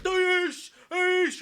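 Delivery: high, loud "Aish!" cries; hammed-up pained squeal; mock strangled yelp. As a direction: yelp as if caught in a choke hold. Man caught in death grip.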